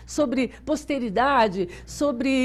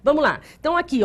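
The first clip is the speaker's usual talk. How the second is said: normal talking voice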